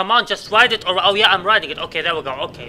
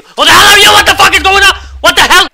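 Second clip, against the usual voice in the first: very high, very loud shocked scream; screaming in sudden fright; startled scream; 12 semitones higher, 12 dB louder than usual. Real, not acted. Gamer screaming at a stranger appearing in the house.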